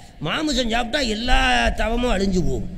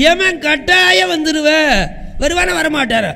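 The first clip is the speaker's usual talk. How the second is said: emphatic preaching; 7 semitones higher, 7 dB louder than usual. Acted. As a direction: boom out that death will come. Preacher booming about Yama. (எமனைப் பற்றி உரத்த எச்சரிக்கை)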